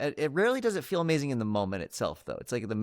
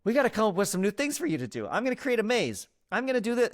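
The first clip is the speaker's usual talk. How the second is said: mock eager parent; 11 semitones higher than usual. Parent inventing games for child.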